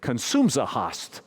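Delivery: slow, imitated Croatian accent, words slurred into one; thick Croatian accent. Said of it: "but it was thick. He said".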